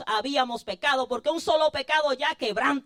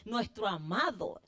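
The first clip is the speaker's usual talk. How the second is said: softer, lower, confiding preacher's voice; intimate sermon; 3 semitones lower, 6 dB quieter than usual. Acted. Preacher confiding to his flock, soft intimate appeal. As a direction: draw them close, speak it tenderly.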